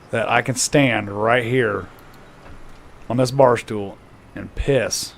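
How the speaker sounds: slow, drawn-out proposition with long pauses; deliberate wager. Acted. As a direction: lay out the bet piece by piece. Patron lays out a bet.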